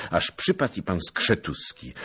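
ordinary delivery

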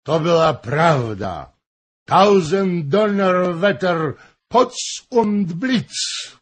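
loud, high, drawn-out cursing; angry. A narrator's reading. Drunken Hoym swears his boast was true, furiously. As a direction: thunder the oath slowly, in a rage. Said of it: "rzekł z gniewem".

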